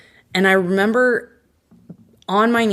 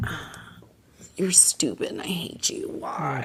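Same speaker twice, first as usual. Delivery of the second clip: mock-annoyed whisper, much softer; a hushed, playful grumble; whispered complaint; 2 semitones lower, 10 dB quieter than usual. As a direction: hiss it under your breath like a sulky prayer. Retold complaint to God.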